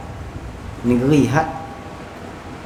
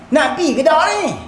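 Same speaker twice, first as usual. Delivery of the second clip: high-pitched exasperated question; acted impatience; 7 semitones higher than usual. Traveller asking whether the companion is coming, impatiently. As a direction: demand an answer, pitched up.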